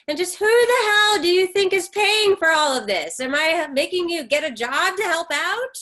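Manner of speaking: loud indignant tirade; furious yell. Acted. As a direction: shout down the ungrateful daughter. Mother yells about paying.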